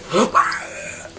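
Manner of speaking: sharp gasp into a high mock shriek; breathy pretend scream, held back in volume; demonstrated scream. Girl predicting her haunted maze screams, high mock shriek. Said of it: "I'm gonna be like".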